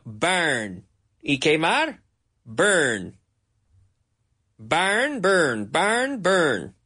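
ordinary talk, nothing marked

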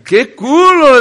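imitating men admiring her in the street; catcall. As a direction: holler it like a man in the street. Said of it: "le decían los hombres".

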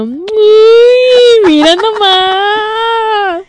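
high, long drawn-out ooh; teasing amazement. Real, not acted. Co-host teasing about the machete under the bed, mock amazed. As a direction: stretch it out in mock wonder.

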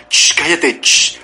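sharp "shh" around a mock telling-off; shushing. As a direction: hush someone with hissed shushes. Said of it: "O cuando dice".

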